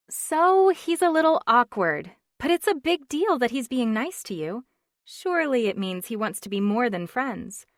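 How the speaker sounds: lightly voiced chatty friend; flustered friend on the phone; Stephanie, chatty friend.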